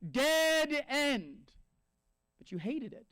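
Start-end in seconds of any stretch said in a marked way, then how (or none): 0.1-1.5 louder, stressed dead end; mock exasperated sign; exasperated emphasis. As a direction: hammer home it's really over. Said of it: "the next sign is like".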